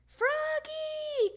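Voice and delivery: high, drawn-out call from another room. A narrator's reading. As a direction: sing out the name loudly, stretched high. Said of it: "called his mom".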